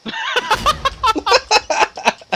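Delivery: long squealing laugh that falls; high falling laugh. Real, not acted. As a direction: laugh out high, then let it drop. Bursts out laughing.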